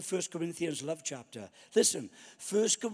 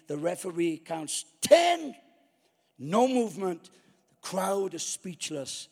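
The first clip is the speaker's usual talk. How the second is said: ordinary delivery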